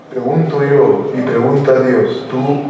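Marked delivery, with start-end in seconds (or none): none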